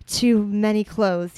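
slow and loud, drawn out; slow heavy line. Acted. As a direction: drag each word out hungrily. Reading Ron's line slowly loud.

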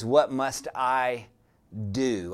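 slow lead-in, then a weighty, falling "do"; stress on DO. Shown as a demonstration. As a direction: set "do" apart with a pause for emphasis. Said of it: "I want to put emphasis on that word".

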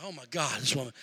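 low, quiet grumble; hushed, retold exasperation; exasperated aside. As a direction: complain under your breath about her. Exasperated aside about wife.

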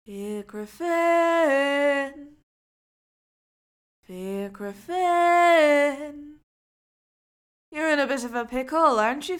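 loud, far strange voice; mysterious stranger. Acted. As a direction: tempt him slyly. Strange voice from behind.

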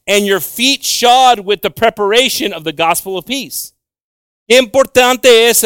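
forceful, high-pitched preaching; scripture proclamation. Real, not acted. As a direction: proclaim it to the whole congregation. Reads verse aloud, then exclaims.

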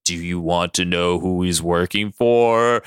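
low, slow looming voice; mysterious voice. Acted. Somebody's voice breaks in with a question, low and mysterious. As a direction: drop low and draw out the question. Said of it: "somebody's voice goes".

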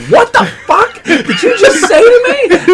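high, slow mock-outraged shriek, laughing. Retelling to friends, laughing, shrieks in mock outrage. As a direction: squeal in laughing disbelief. Outraged reply to scammer insult.

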